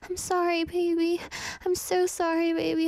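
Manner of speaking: put-on high, even, apologetic girlfriend; Nikki. Toxic girlfriend saying sorry to baby, high and pleading. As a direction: apologize in a high, held, sugary voice. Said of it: "You know how she keeps saying".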